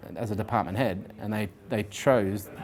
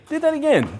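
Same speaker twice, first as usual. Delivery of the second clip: mock amazed; 6 dB louder than usual. Recruiter impressed by role play.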